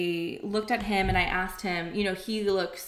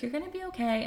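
soothing mom; 7 semitones higher, 5 dB quieter, faster than usual. Retold or imitated. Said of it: "she's like".

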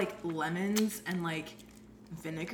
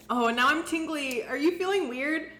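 excited; 7 semitones higher, 8 dB louder than usual. Real, not acted. Tongue tingling from tablets.

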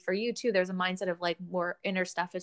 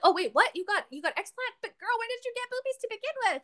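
mock shocked; 14 semitones higher than usual. Friend retelling her shocked reaction, high squeal. Mock surprised quote of herself.

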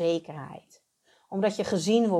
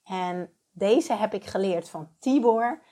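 normal talking voice

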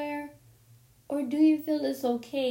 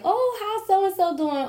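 nosy relative voice; 10 semitones higher, 4 dB louder than usual. Retold or imitated. Imitating family asking again.

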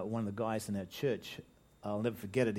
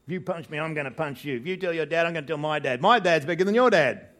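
higher-pitched boasting, escalating to loud taunts; squabbling playground kids; 4 semitones higher, 9 dB louder than usual. Acted. Preacher acting out two boys trading threats, escalating louder. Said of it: "two kids in the playground".

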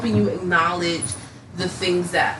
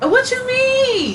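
high sing-song; much slower than usual. Real, not acted. S0 in high, slow, put-on voice.

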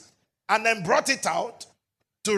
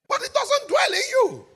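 preaching cry. Acted. High pulpit cry.

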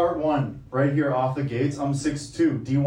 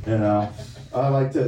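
usual speaking voice

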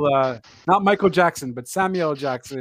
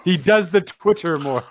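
teasing through a laugh; 2 semitones higher, 4 dB louder than usual. Real, not acted. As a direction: chuckle the tease out.

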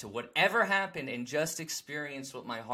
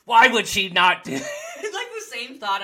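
laughing; 10 semitones higher, 13 dB louder than usual. Real, not acted. Laughs at his own thought.

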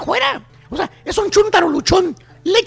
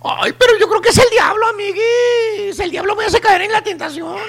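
mock tearful excuse. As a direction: fake crying, stretching "amiguis" very long. Wife whines excuse to her friend.

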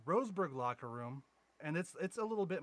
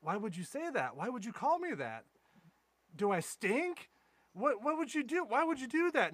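mock hurt whine; 8 semitones higher, 3 dB louder than usual. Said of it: "tears start welling up".

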